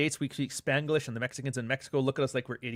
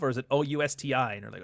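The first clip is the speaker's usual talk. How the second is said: ordinary delivery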